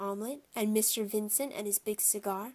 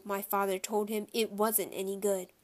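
ordinary delivery, nothing marked

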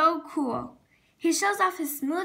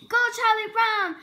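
a higher, louder cheer from the crowd; root for him from the stands; cheering shout; 6 semitones higher, 4 dB louder than usual. A narrator's reading. Linus cheers Charlie Brown on at the dance, higher cheering shout. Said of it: "Linus shouts".

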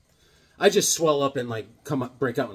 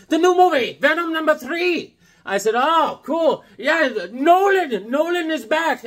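excited fan voice; 10 semitones higher, 4 dB louder than usual. Retold or imitated. Amir and teller dialogue.

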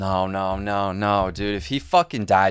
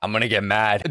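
ordinary talk, nothing marked

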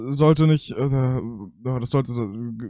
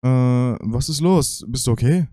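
ordinary talk

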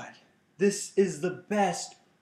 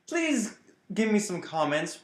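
normal talking voice

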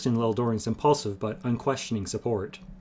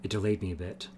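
softer, low-key explanation; laconic; 3 semitones lower, 7 dB quieter than usual. A narrator's reading. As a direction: play the delay down quietly. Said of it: "remarked laconically by way of explanation".

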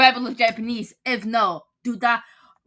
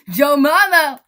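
loud punchline; 3 semitones higher, 9 dB louder, slower than usual. Acted. Punchline of joke.

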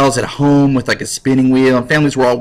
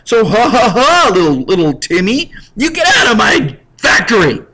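gloating fake laugh at little Timmy; mocking factory boss; 7 semitones higher than usual. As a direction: taunt like a cartoon villain. Boss throws out maimed Timmy.

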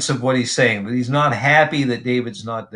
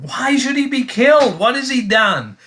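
pitched up in mild disbelief; a son's shocked plea, much higher; Jonathan protesting; 10 semitones higher, 3 dB louder than usual. Retold or imitated.

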